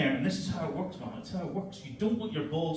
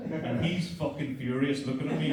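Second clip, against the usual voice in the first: ordinary talk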